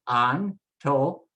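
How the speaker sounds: Antonio broken slowly into an-TOH-nee-oh; syllable by syllable. Shown as a demonstration. Teacher drops the consonants in for learners, sounding out syllables. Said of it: "now just drop the consonants in".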